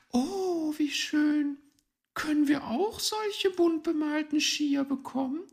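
put-on very high child voice; high-pitched admiring little one; delighted children. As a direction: pipe up in wonder and pleading. Forest children admire the skis.

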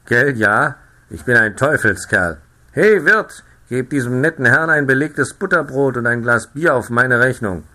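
loud, boastful farmer calling to the innkeeper; jovial farmer. A narrator's reading. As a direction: play the hearty farmer. Jovial farmer orders for narrator. (lauter, prahlerischer Bauer)

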